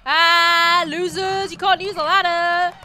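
loud, high taunt; crowing, high and very loud; gloating shout. Real, not acted. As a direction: yell a jeer at the losers. Taunting game enemies loudly.

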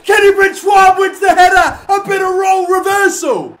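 yelling with delight at the goal; excited shout. Real, not acted. Gamer cheering his side's header goal, loud and thrilled.

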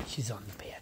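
soft unvoiced whisper. Real, not acted. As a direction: hush it to the dog. Dog settles on bed.

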